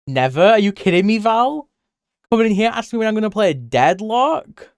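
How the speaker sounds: high, loud mock outrage. Streamer to viewer Val, loud high mock outrage. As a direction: act scandalised at the question.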